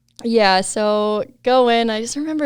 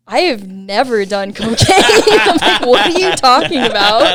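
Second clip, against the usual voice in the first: laughing protest; 2 semitones higher, 8 dB louder than usual. Retold or imitated. Patient answers doctor's cocaine question, laughing mock protest. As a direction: protest in mock shock while cracking up. Retold shock, cracking up.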